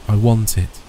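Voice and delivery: usual speaking voice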